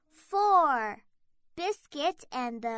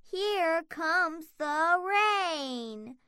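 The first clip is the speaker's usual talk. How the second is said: usual speaking voice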